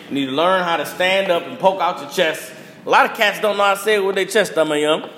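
ordinary talk

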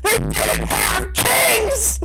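indignant slow yell; outraged shout. Real, not acted. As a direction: drag it out in exasperation. Outraged repeat.

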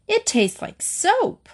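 slow, high-pitched disgust. A narrator's reading. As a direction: draw it out in distaste. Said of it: "lips stretched down in disgust".

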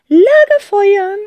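high, loud, slow command; firm old aunt. Acted. Aunt Rotz decides the plan for the panicking twins, firmly. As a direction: announce it like a decision. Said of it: "sagt Tante Rotz bestimmt".

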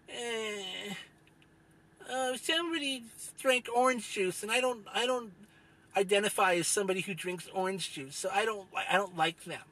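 mock the easily offended in a sneering falsetto-ish whine; mocking whiny Zoomer.

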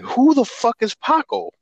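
high, incredulous who-the-hell; baffled. Retold or imitated. Confused retort in story.

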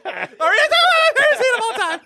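very high, fast squeal; hammed-up frenzied shriek through laughter; over-the-top excitement. Repeats the question, escalated.

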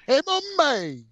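high, playful goofy voice; silly put-on voice. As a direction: toss it off in a high joke voice.

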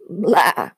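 short throaty goat bleat; irritated bleat. A narrator's reading. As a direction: bleat it out in annoyance. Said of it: "more of an irritated bleat".

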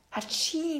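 acted sneeze. A narrator's reading. Mama Bär catching a cold, sneezing. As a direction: fake a sneeze in words. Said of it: "nieste Mama Bär".

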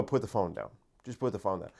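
soft, low, unbothered; calm quiet. Shown as a demonstration. To online critics, a soft calm brush-off. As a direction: shrug it off softly. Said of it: "My new thing, I just go".

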